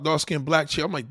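normal talking voice

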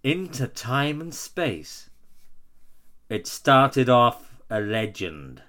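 slow, solemn poem reading; poem recital. Acted. Continues reciting the lyrics.

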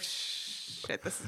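whispered mock dread; hushed. Host voicing a look exchanged with Rob, whispered. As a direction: hush it like a shared secret.

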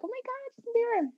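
put-on high-pitched swooning fan girl; gushing fangirl. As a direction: squeal it like a starstruck fan. Girls gushing over [name].

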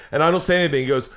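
normal talking voice

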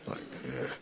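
low, muttered inner grumble; grumbling inner voice. Retold or imitated. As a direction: mutter it under your breath. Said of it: "voices started to come back in me".

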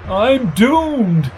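high, drawn-out quivering dismay; quivering yell. A narrator's reading. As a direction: wail it like a doomed man. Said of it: "yelled Lark in a quivering voice".

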